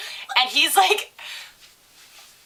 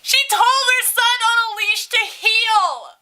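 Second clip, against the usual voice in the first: high, loud, drawn-out disbelief; incredulous outcry; 11 semitones higher, 6 dB louder, slower than usual. Real, not acted. Teller telling the punchline, loud scandalized outcry. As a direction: wail the punchline slowly and high.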